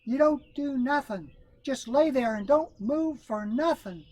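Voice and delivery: high, slow firm instructions; Brer Rabbit voice. A narrator's reading. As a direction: lay it down slowly.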